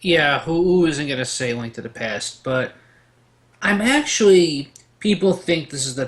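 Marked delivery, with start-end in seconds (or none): none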